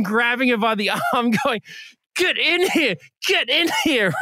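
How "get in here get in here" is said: high-pitched, exasperated command; acting out dragging someone inside; heated, high urging; insistent ordering.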